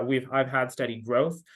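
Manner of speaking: ordinary delivery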